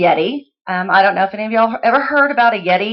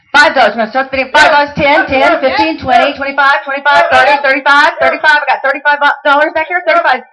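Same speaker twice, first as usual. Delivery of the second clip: high, loud auction chant; student auctioneer spinning the bids, loud and high; auctioneer bid calling; 6 semitones higher, 6 dB louder than usual. Acted. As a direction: rattle off bids like a pro auctioneer.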